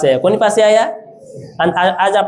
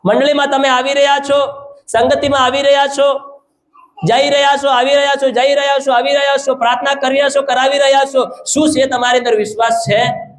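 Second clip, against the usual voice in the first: raised, insistent preaching in a repetitive rolling chant; forceful preaching; 8 semitones higher than usual. Real, not acted. Preacher challenging the congregation on whether they have faith, forceful preaching. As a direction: drive each phrase home at a high, sustained pitch.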